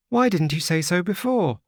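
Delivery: put-on high, bright-eyed little girl, pleased; a child relieved and delighted; gleeful child. A narrator's reading. Little girl gleefully answers the wanderer, high childlike voice. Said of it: "laughing gleefully".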